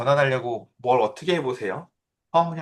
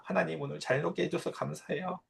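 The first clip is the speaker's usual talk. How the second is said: put-on child's soft prayer; 2 semitones higher, 10 dB quieter than usual. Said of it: "뒷자리에 앉아서 기도를 해요".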